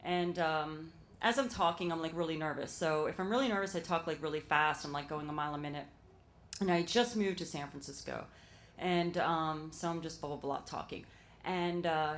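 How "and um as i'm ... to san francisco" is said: ordinary delivery, nothing marked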